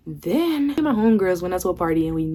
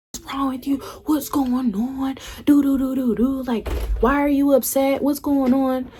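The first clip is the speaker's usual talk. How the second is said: higher, pleading friends' voices; imitating worried friends; 6 semitones higher than usual. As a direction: mimic her girls fussing over her. Homegirls asking her, retold.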